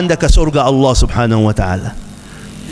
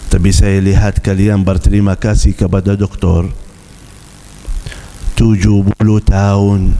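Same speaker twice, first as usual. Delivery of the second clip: put-on old father; 7 semitones lower than usual. Old father explains tearfully. (suara ayah tua yang rendah dan menyesal)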